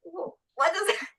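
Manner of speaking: high confused question; puzzled, half-laughing disbelief; baffled. Retold or imitated. Baffled reaction to message.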